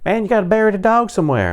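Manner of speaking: high, quick neighbor caricature; neighbor guy. Retold or imitated. Neighbor back with a shovel, high quick caricature. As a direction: mimic the neighbor in a rushed, high-pitched voice. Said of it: "and he goes".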